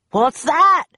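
high, loud startled demand; pirate shout. A narrator's reading. Captain Bones hears a shriek, shouting. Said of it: "he shouted".

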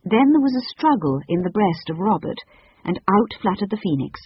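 usual speaking voice

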